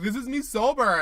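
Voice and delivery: imitating Liz's accent. Podcaster imitates contestant Liz on the show, mock accent. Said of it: "You did a pitch perfect impression".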